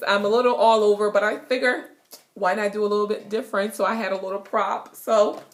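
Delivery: usual speaking voice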